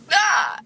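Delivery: sharp squeaky hiccup; loud hiccup. Real, not acted. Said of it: "I'm recording my hiccups".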